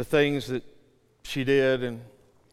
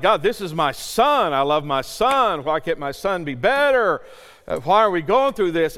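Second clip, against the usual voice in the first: loud, raised, impassioned protest; angry with God; 4 semitones higher, 7 dB louder than usual. Retold or imitated. Father telling God about his son, angry. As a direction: cry out to God in retold anger. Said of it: "angry with God".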